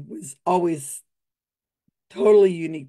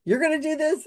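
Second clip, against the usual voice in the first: pitched up in teasing disbelief; mock disbelief; 8 semitones higher than usual. Host teasing drunk volunteer, pitched-up mock disbelief. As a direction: act amazed he'd volunteer. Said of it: "So [name] goes".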